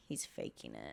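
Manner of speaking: very low, hushed whisper; dismissive aside. Retold or imitated. Guest recalls joking about his critical condition, hushed dismissive whisper. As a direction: whisper it almost under your breath.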